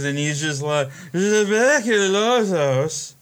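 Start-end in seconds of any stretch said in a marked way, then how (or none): 1.1-3.1 put-on drunk slurring. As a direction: slur it like a hammered bar drunk. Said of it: "he's slurring his words".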